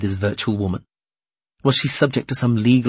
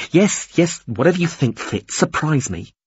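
higher, brisk interruption; exasperated raised-pitch assent; impatient; 6 semitones higher than usual. Acted. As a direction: brush it aside impatiently. Captive impatiently cuts in.

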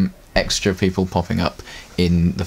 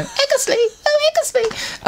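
squeaky character voice. Retold or imitated. Calling Egglesby in a very high squeaky character voice. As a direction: stretch the name out in a tiny high voice. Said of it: "it was just like".

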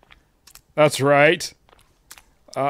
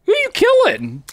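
high squeaky mock voice; teasing in a tiny high voice. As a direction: squeak it out like a cartoon. Squeaky taunt at game player.

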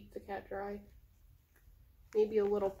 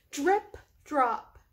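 higher, louder drip drop then slow question; sing-song reading; 5 semitones higher, 8 dB louder than usual. A narrator's reading. Reading rhyme to her cats, sing-song. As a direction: read it like a nursery rhyme. Rhyming read-aloud.

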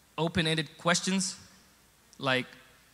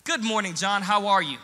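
higher, forced-polite greeting; mad but sounding nice; 5 semitones higher, 8 dB louder than usual. Retold or imitated. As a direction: seethe behind a nice hello. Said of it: "really mad, but you sound nice".

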